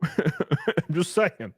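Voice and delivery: slow, giggly disclaimer; amused, chuckling aside; laughing through words. Real, not acted. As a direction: let the laugh run into the words. Laughing off his own joke.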